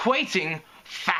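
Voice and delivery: put-on high, accented disdain; scoffing. A narrator's reading. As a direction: scoff in a thick German-style accent. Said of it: "Photo Finish scoffed".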